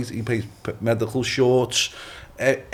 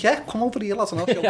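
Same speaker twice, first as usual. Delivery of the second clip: mock challenge; 10 semitones higher, faster than usual. Mock challenge to doubters, raised pitch.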